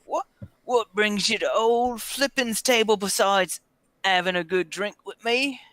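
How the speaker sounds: Zara. Acted. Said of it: "trying to do, you know, the, Zara's voice".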